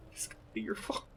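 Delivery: whispered teasing jab; playful whispered blame; mock mean whisper. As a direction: whisper it like a mean little joke. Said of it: "That's so mean".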